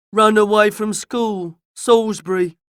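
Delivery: loud, slow rustic villager; Joe. A narrator's reading. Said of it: "said Joe".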